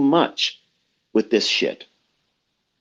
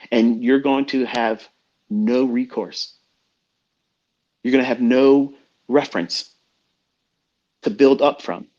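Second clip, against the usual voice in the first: usual speaking voice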